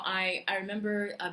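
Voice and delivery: ordinary delivery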